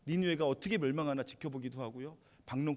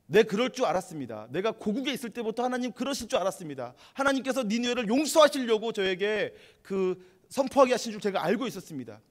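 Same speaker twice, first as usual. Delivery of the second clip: loud, higher, indignant complaint; angry outburst; 7 semitones higher, 8 dB louder than usual. Retold or imitated. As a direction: accuse God as resentful Jonah, voice raised. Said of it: "요나가 하나님께 막 화를 내요".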